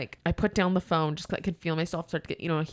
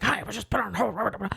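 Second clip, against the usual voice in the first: mock rant trailing into garbled blah; hammed-up complaining customer; imitated angry caller; 2 semitones higher, faster than usual. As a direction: mimic an irate caller, not truly shouting. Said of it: "he's just yelling".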